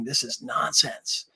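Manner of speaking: lightly gruff, slow and hushed. Retold or imitated. Medium quotes the spirit's complaint, lightly gruff and hushed. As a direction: quote it low-key, slow, a hint of gruffness. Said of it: "the gruff voice saying".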